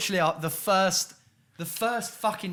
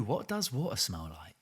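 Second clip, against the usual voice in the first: whispering; 5 semitones lower, 5 dB quieter than usual. Acted. Mock-mysterious question to co-host.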